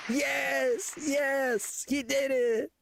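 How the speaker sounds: high cheering crowd voice; excited crowd cheer. Acted. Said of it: "the crowd loses their minds".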